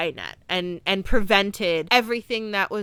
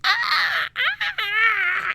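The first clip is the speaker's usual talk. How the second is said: high, far-off alien screeching cries; 12 semitones higher, much slower than usual. Retold or imitated. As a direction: screech like a strange alien creature. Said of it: "like, screeching".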